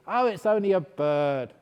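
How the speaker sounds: loud, offhand policeman; police dismissing. Retold or imitated. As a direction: brush it off loudly.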